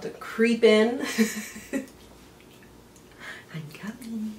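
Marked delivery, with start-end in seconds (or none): 3.5-4.3 unvoiced, ominous whisper of a looming gloom; creepy whispered threat. Creator voices seasonal depression creeping in, whispered menace. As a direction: play the winter blues sneaking up.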